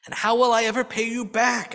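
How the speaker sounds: mild weary groan of a poor sick woman; lightly voiced groaning widow. A narrator's reading. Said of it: "asks the poor woman, groaning".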